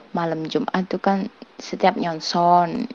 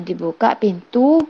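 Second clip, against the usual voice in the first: ordinary talk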